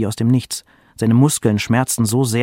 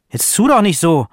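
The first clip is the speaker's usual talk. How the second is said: higher, louder scolding; sharp tone; 7 semitones higher, 5 dB louder than usual. A narrator's reading. Mother snaps at her questioning son, sharp tone. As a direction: cut off his innocent act. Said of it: "der Ton wurde scharf".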